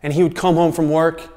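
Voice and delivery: usual speaking voice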